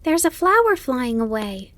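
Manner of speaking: high, eager little fawn voice; young Bambi, excited. Acted. Young fawn pointing out a flower to his mother, excited. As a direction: pipe up high in wonder.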